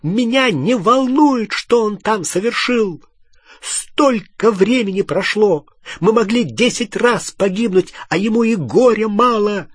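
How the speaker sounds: high, loud angry outburst; furious high-pitched ranting; yelling tirade. A narrator's reading. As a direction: keep screaming the grievance at him. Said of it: "завопила Гермиона".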